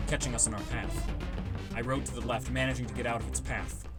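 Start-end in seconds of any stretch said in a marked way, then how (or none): none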